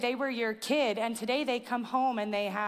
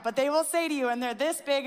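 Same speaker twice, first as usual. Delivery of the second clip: ordinary delivery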